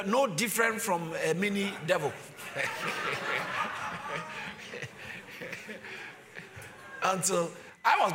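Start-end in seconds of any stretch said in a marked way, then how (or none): 2.2-6.1 soft laugh. Real, not acted. Laugh after mini devil joke.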